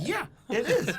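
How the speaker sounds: high and loud "Yeah, it is"; high punchline. Retold or imitated. Answering his own question, a high loud punchline. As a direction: hit the punchline hard. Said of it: "punchline answer".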